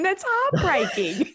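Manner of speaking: high, drawn-out laughing dismay; laughing sympathy. Real, not acted. Host reacting to a sad, funny story, laughing in sympathy. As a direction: draw it out high through laughter.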